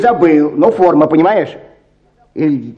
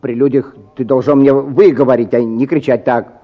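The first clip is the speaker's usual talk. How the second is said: usual speaking voice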